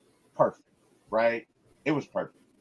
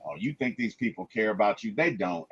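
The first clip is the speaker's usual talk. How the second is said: lower, softer taunting villain; menacing Void; 7 semitones lower, 7 dB quieter than usual. Retold or imitated. The Void taunting Robert, low and quiet. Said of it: "the Void says to him".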